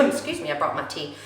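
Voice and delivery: usual speaking voice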